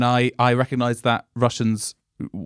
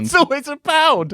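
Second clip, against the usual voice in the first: much higher, squeaky mock echo of the tourist; 13 semitones higher than usual. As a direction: join in the joke in a silly high voice. Host echoes guest's tourist bit.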